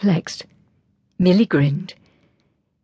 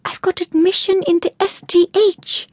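excited squeal; 13 semitones higher than usual. A narrator's reading. Friend announces her admission excitedly.

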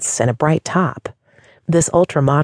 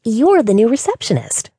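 excited; 8 semitones higher than usual. Acted.

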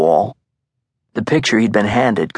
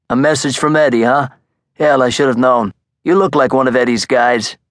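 higher, louder scoffing mob boss; mocking fat gangster voice; amused Brooklyn mobster; 4 semitones higher, 3 dB louder than usual.

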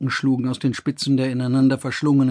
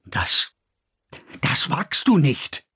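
stuttering; slower than usual. A narrator's reading. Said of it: "stotterte er".

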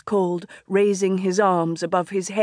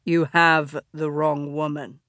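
low, smoky male voice; deep smoky voice; 5 semitones lower than usual. A narrator's reading. Wolf speaks, deep voice.

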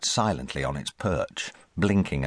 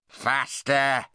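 high squawking parrot shout; 9 semitones higher than usual. Acted. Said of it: "it shouted".

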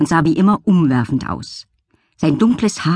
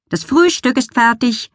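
higher called-out announcement; calling from downstairs; 7 semitones higher than usual. Acted. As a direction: call it up the stairs. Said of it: "drang die Stimme von Lillys Vater herauf".

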